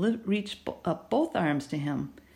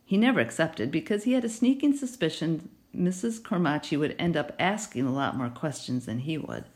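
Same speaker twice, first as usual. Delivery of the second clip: usual speaking voice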